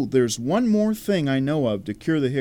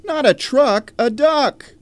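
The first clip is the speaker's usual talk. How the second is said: exasperated correction; 10 semitones higher, 3 dB louder than usual. Acted.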